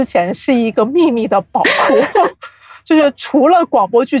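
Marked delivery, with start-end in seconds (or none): 1.7-2.5 a quick amused chuckle; short laugh. Real, not acted. Host chuckles about danmei as a hidden treasure, short laugh.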